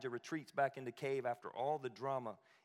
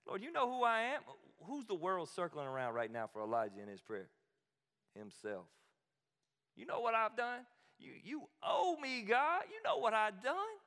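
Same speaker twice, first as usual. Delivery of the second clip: higher, whiny demanding complaint; indignant Elijah; 9 semitones higher, 4 dB louder than usual. Retold or imitated. Preacher voicing Elijah demanding God owes him, higher and whiny. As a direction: demand what you're owed from God.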